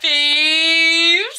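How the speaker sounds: loud call; shouting. Real, not acted. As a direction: call out loudly to friends. Calling out loudly.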